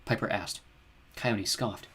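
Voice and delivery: usual speaking voice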